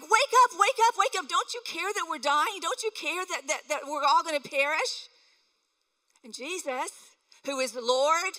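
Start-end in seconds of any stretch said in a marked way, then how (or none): 0.0-5.1 high, loud frantic cry; panicked pleading. Retold or imitated. Disciples in the storm begging sleeping Jesus, panicked and loud. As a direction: shout them awake in panic, then plead. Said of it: "the disciples were like".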